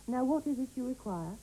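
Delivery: ordinary delivery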